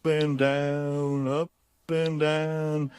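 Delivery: slow. Shown as a demonstration. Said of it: "Maybe they like to go slow".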